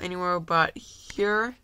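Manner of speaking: ordinary delivery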